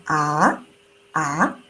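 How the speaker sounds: quiet low "Ah" gliding up; rising second tone. Shown as a demonstration. Teacher demonstrating a soft rising "Ah". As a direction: softly rise through "Ah". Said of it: "second tone is like".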